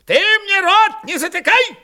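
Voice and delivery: very high, loud, furious snap back; angry retort. A narrator's reading. Old man tells the officer not to shut him up, angry. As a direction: flare up at being silenced. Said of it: "обозлился Истырь".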